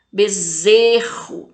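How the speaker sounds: "Bezerro" slowly, ZE drawn out; long, emphatic ZE in "Bezerro"; tonic syllable stressed. Shown as a demonstration. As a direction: punch and hold ZE in "Bezerro". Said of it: "fala com mais intensidade".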